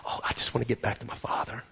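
hushed, fast, breathy humble plea; almost whisper it, like a son begging to come home; whispered humble longing. Retold or imitated. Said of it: "the humble attitude that says".

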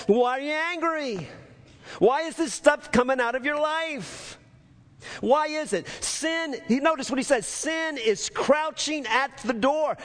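high, urgent sermon voice; impassioned preaching. Real, not acted. Preacher voicing God questioning Cain's anger, urgent preaching. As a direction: press the warning on them, pitch held high.

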